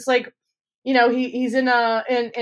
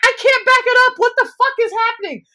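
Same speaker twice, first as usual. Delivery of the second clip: frantic disbelief; 13 semitones higher, 5 dB louder than usual. Retold or imitated. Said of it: "And I was like".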